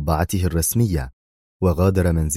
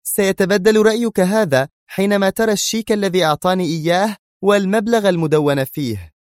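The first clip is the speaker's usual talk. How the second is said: angry woman; 12 semitones higher than usual. A narrator's reading. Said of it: "أجابته في غضب".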